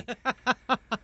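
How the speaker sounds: high laugh. Real, not acted. Laugh after story.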